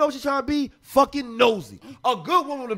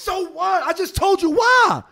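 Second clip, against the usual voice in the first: higher-pitched, exasperated comeback; heated retort; 8 semitones higher than usual. Real, not acted. Man defending his friend to his critics, heated retort. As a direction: throw it back in protest.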